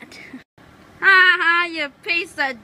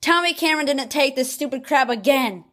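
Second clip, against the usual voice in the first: ordinary talk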